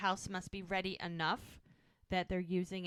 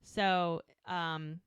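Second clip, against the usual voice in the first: ordinary talk, nothing marked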